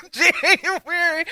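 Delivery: high, drawn-out word through real laughter; laughing at how little money she had. Real, not acted. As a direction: stretch it slowly while cracking up. Laughing at her own budget.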